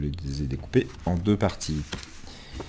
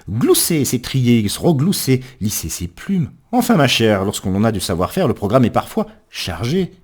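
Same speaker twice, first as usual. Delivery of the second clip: high, loud, animated verse reading; lively comic recitation; 6 semitones higher, 5 dB louder than usual. Acted. Reader telling of a coquettish hen, high animated poetic recitation. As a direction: play up the fussy hen's busy list with gusto. Reads a comic poem with gusto.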